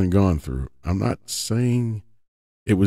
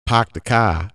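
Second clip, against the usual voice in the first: Boston accent, dropped r's: "Park the car" as pahk the cah. Shown as a demonstration. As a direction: drop every r like a Boston local. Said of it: "if I go to Boston and I say".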